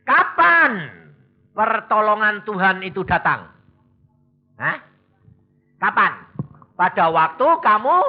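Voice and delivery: usual speaking voice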